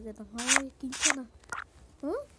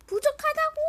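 frustrated yell; 13 semitones higher, 6 dB louder than usual. Real, not acted. Frustrated at lacking blocks.

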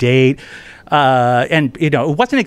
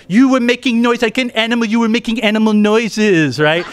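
indignant French student; 7 semitones higher, 4 dB louder than usual. Retold or imitated. Student accuses him, higher voice.

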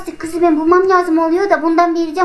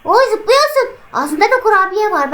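usual speaking voice

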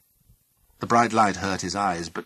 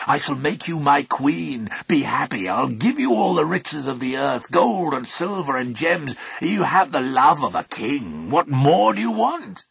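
raised, loud and grand wooing; insistent god; 6 semitones higher, 5 dB louder than usual. A narrator's reading. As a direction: press the offer, climbing to an exasperated question. Pluto urging his captive.

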